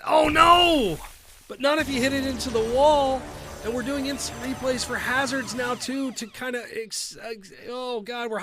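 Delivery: high loud cry of dismay; shocked outcry. Real, not acted. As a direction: yelp as the ball hits the wall. Shot hits the wall.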